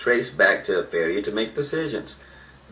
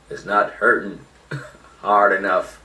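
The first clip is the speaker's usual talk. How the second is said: ordinary delivery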